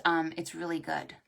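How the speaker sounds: ordinary delivery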